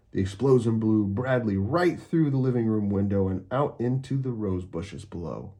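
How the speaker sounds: plain reading voice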